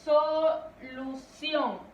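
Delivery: solución drawn out slowly, leaning on the last syllable "ción"; stress on final syllable. Shown as a demonstration. (solución muy lento, alargando y acentuando "ción")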